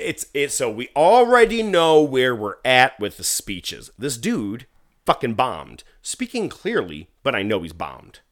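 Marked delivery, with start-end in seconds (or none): none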